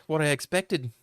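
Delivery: normal talking voice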